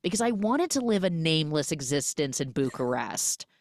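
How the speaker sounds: high, mocking sarcasm; sarcastically brushing off his apology; wry joking to her husband; sarcastic retort. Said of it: "And she's like".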